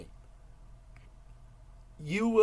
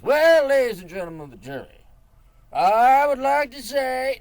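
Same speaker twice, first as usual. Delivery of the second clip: Southern governor orator; 10 semitones higher, 13 dB louder than usual. Retold or imitated. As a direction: play a grandiose old Southern politician addressing the jury. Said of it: "more of a Southern Louisiana governor".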